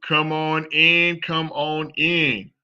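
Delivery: drawn-out, welcoming come on in; slow inviting call. Real, not acted. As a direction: slowly beckon people inside. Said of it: "inviting viewers to join".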